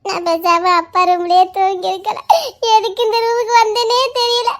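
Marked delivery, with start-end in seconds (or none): none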